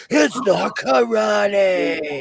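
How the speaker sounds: long, drawn-out mock yell; exasperated shout. Imitating son driven crazy by MMA called karate, exasperated shout. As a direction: stretch every word in exasperated protest. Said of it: "it drives him crazy sometimes".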